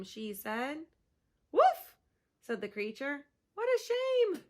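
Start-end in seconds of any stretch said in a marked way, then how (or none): 1.5-1.9 high loud woof; dog woof. Acted. Sled dog answering Pip with a loud woof. 3.5-4.4 high, sorry dog voice; mock dismay. Dog telling Pip she is sorry, high.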